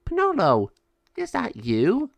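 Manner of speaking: startled delight at a familiar face; surprised. Acted. Sabio sees Penelo appear.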